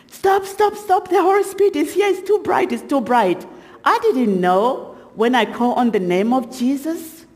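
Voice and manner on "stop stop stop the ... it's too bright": retold screaming. Demon in the lady recoiling from the Holy Spirit, imitated screaming. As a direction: imitate a panicked shriek, pitched far up. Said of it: "she closes her eyes and she's screaming".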